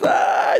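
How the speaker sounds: a short breathy unvoiced "ah" scream; mock cry of pain out loud; imitated scream. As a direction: shul man screaming in prayer. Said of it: "he started like this".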